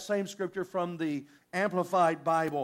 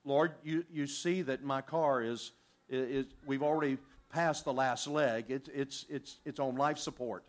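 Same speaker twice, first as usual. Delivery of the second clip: quiet earnest prayer; 7 semitones lower, 4 dB quieter than usual. Retold or imitated. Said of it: "we give God a very generic".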